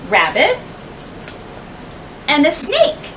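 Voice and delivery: usual speaking voice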